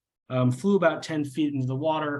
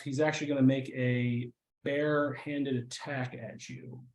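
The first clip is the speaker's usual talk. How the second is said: ordinary delivery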